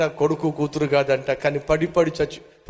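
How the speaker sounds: ordinary talk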